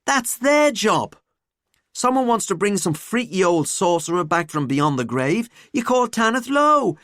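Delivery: lively, scornful student voice; ranting student. Acted. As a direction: rant like an incredulous pupil. Student rants about adventurers.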